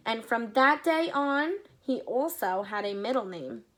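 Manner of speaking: lively read-aloud storytelling; story narration. A narrator's reading. Reading a story aloud to children, lively. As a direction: read it to the kids, paint each scene.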